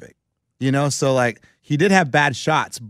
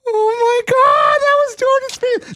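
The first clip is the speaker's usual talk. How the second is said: very high, slow falsetto shriek; mock squeal of giddy fans, drawn out; starstruck squeal; 5 dB louder, slower than usual. College girls gushing over a famous golfer, squealing. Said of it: "and they all go".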